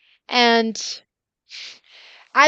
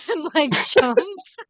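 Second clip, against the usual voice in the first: usual speaking voice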